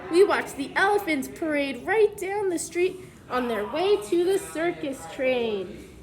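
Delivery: put-on clear, even storybook read-aloud for kids; reading to children. Teacher reads the book to the class, storybook read-aloud voice.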